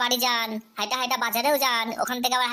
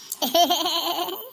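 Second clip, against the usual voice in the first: bursting into a giggling laugh; 2 semitones higher than usual. Acted. Student laughs at his own joke in front of teacher, giggling. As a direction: cackle with glee at the joke. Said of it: "এই এমনি sir".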